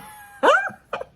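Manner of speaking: loud, high-pitched burst of laughter; real laugh. Real, not acted. Laughs at her own aside.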